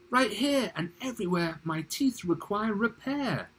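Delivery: high-pitched crocodile character voice; theatrical high croc voice; demanding crocodile. Acted. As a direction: play a crocodile complaining in a high voice. Crocodile speaks in recited poem.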